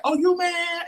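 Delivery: very high, campy needling. Acted. Coworker needling the offended younger man, high mocking question. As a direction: tease in a high put-on voice. Coworker teases his attitude.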